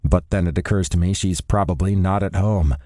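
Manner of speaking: ordinary delivery, nothing marked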